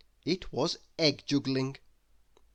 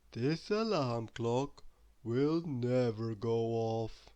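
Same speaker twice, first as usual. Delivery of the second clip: sleepy; 3 semitones lower, 3 dB quieter, much slower than usual. Acted. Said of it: "said Mister Lazy sleepily".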